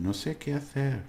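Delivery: "hacer" lifted high, as a question; question intonation. Shown as a demonstration. Teacher modelling a Spanish question for students, questioning tune. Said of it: "it's a question".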